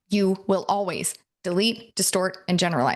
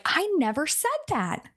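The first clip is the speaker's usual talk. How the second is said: high-pitched, mock-offended denial; acted indignation, pitched well up; indignant couple's-fight protest; 9 semitones higher than usual. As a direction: protest as in a lovers' tiff. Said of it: "a couples fight with somebody and you're like".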